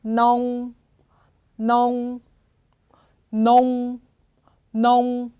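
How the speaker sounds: 농 held, lips sealing on the final sound; lips closed at end. Shown as a demonstration. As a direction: model 농 ending in a closed-mouth hum. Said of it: "입을 다물어 주셔야 돼요".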